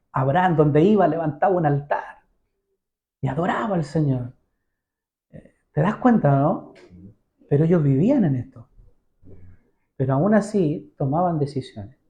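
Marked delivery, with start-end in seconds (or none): none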